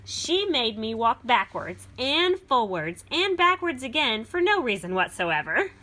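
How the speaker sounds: put-on lively read-aloud for children; storybook reading. A narrator's reading. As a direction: read it like a picture book to a class. Reads beast's version.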